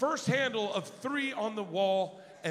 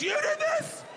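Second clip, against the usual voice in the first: shocked squeal. Retold or imitated. Dad accusing son.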